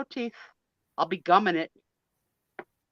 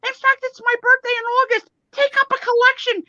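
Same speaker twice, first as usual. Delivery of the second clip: excited; 7 dB louder than usual. Real, not acted. Excited birthday demand, laughing.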